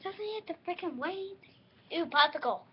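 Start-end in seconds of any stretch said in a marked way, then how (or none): 0.0-1.4 hushed unvoiced aside; whisper. Real, not acted.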